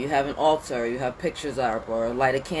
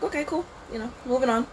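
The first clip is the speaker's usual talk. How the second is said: breezy high mock-cheerful shrug-off; dismissive brush-off; 10 semitones higher, faster than usual. Shrugging off family disapproval, breezy and dismissive. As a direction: brush off the family's judgment lightly. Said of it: "I was like".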